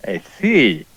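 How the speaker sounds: talking with an envelope in mouth; muffled through a mouthful of paper. Acted. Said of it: "Why is your voice muffled".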